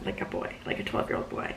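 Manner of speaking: hushed self-mocking whisper; whispered aside. Real, not acted. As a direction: drop to a whisper for the joke.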